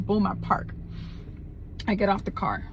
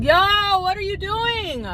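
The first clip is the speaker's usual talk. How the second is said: very high, wailed exclamation; incredulous outcry; 12 semitones higher, slower than usual. Acted. Woman exclaims at a would-be kidnapper's scheme, incredulous wail. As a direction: launch a drawn-out squeal of disbelief.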